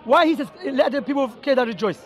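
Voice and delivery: usual speaking voice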